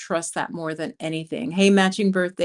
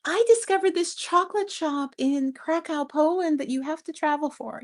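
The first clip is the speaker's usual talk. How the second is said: gushing travel influencer; 9 semitones higher than usual. Retold or imitated. Vlogger mocking shallow travel videos, in a high gushing voice. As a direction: parody a breathless travel vlogger raving. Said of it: "not just".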